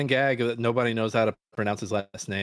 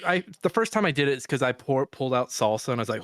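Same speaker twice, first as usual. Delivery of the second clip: ordinary talk, nothing marked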